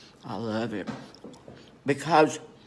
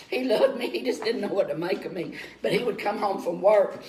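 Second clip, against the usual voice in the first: usual speaking voice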